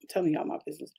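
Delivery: soft unvoiced whisper; whispered aside. Real, not acted. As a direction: lean in and whisper it to the audience. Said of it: "whisper aside".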